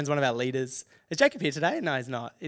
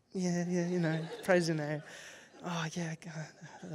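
half-hearted muttered praise, fading to near whisper; frustrated; 4 semitones higher, 6 dB quieter, slower than usual. Retold or imitated. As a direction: mumble worship you cannot feel. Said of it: "I'm a bit frustrated".

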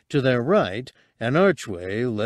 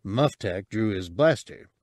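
plain reading voice

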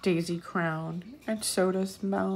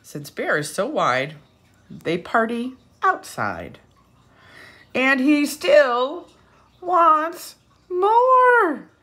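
lively storybook read-aloud; rising to a high, loud finish; reading to children; 4 semitones higher, 7 dB louder than usual. A narrator's reading. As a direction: read it with big storybook energy. Storybook narration.

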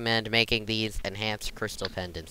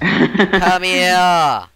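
loud shout; 8 semitones higher, 11 dB louder than usual. Real, not acted. Shouting at the boss.

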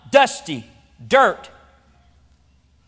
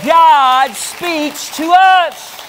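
preaching at full force; 3 semitones higher, slower than usual. Acted. Pastor building to a climax for the church, fervent preaching. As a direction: build the list to a ringing climax.

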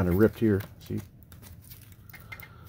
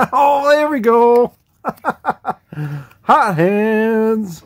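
excited cheer; 11 semitones higher, 7 dB louder, much slower than usual. Real, not acted. Celebrating a chase pull.

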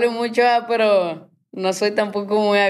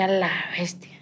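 hushed astonishment; 3 semitones lower, 6 dB quieter than usual. Retold or imitated. Quotes own shock at year passing.

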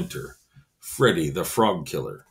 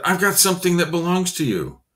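put-on kid voice; 8 semitones higher than usual. Specimen voiced higher.

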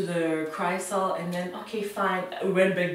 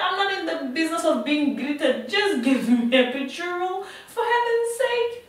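exasperated demand; 10 semitones higher than usual. Retold or imitated. Labouring woman cutting short the doctor's greeting, exasperated. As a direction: re-enact losing patience, pitch climbing at the end.

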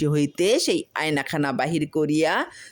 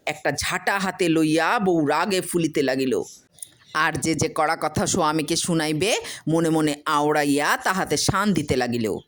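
usual speaking voice

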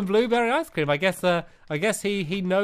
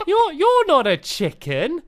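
high, slow, suspicious build; slowly see through the disguise; hammed-up shopkeeper suspicion; mock dawning realisation; 8 semitones higher, slower than usual.

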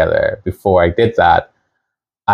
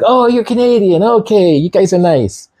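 much higher, chirpy mock voice of strangers; impressed strangers; 11 semitones higher than usual. Strangers meeting a Canadian traveller, a hammed-up high, pleased voice. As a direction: gush with mock relief. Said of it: "because they're like".

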